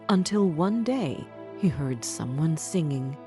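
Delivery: plain reading voice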